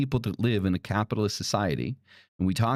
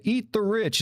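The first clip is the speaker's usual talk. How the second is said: mock protester's chant, pitched much higher; mocking quote; 12 semitones higher, slower than usual. Mocking jealous people who chant the slogan, high sneer. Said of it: "people that are sitting there like".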